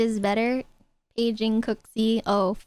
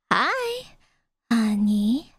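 put-on cutesy baby voice; slower than usual. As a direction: coo it like a baby. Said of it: "how to say it cutely".

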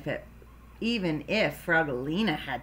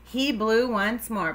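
ordinary delivery, nothing marked

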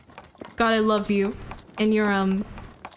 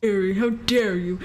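mock deep voice; 5 semitones lower than usual. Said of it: "he has a deep voice".

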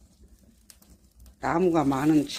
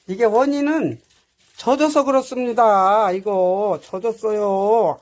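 much louder, fed-up declaration that the wood is wet; exasperated loud complaint; 3 semitones higher, 11 dB louder, slower than usual. Real, not acted. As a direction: drag out 이거 젖었어요 slowly, at full volume, exasperated. Frustrated, wood won't light. (젖은 장작 탓하며 크게 짜증 내듯)

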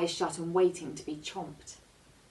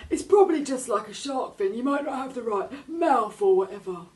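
high, loud, odd cartoon shark voice; a big shark owning up; sheepish shark; 7 semitones higher, 7 dB louder than usual. A narrator's reading. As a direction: shark admitting it was wrong to the girl.

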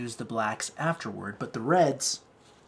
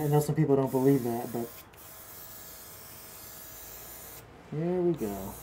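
talking through a face mask; muffled; 3 semitones higher, 8 dB louder than usual. Real, not acted. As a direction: speak muffled behind a mask. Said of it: "I'll have my mask on, so sorry if it sounds a little muffled".

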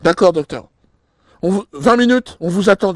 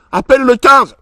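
higher, louder panicked plea; 7 semitones higher, 6 dB louder than usual. Acted. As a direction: beg her to call, panicking.

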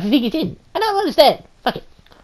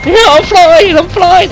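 excited shouting; 5 semitones higher, 8 dB louder than usual. Real, not acted. Thrown flying, excited shout.

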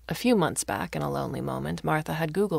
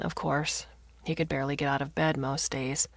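ordinary delivery

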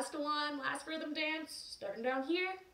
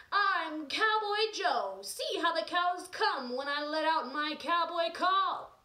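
proud cowboy; 5 semitones higher, 5 dB louder, slower than usual. Acted. Cowboy Joe proudly boasts.

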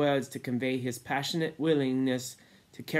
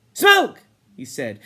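one high, loud cry; sudden shout; 13 semitones higher, 13 dB louder, slower than usual. Acted. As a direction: shout the word out as an urgent demand. Ralph suddenly remembers, demands smoke.

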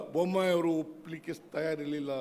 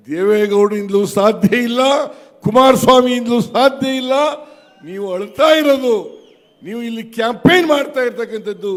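defiant rally shout; 3 semitones higher, 12 dB louder than usual. Real, not acted. Rally speaker defying opponents.

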